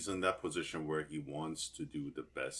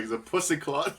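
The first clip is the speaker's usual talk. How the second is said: loud mock Jamaican accent, higher; 10 semitones higher, 8 dB louder than usual. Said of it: "he snaps into it, he's like".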